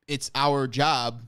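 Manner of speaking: slow, deliberate coach quote; a hint of a measured coach. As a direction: spell it out slowly like a calm coach. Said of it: "his response was".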